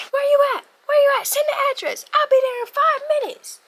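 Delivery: high, loud urgent mom voice; mother urgently asking where her child is, high; mom on the phone. Retold or imitated. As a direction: fire off questions like a worried mom. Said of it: "I'm about to reenact it".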